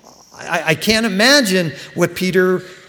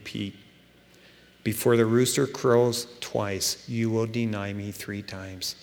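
lower, softer, calm correction; calm Jesus; 5 semitones lower, 4 dB quieter than usual. Retold or imitated. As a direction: settle him down gently. Jesus calmly corrects Peter.

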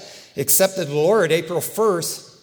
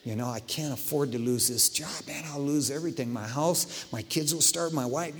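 inner thought; 5 semitones lower, 8 dB quieter than usual. Retold or imitated. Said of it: "you're gonna think".

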